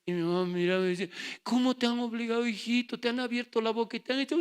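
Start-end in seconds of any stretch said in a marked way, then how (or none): 1.4-4.4 doting mother. Retold or imitated. Mother asks worried.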